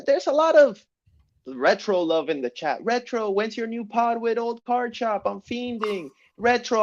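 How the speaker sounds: high-pitched fan voice reading chat; chat reader voice. Acted. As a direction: lightly voice eager fans.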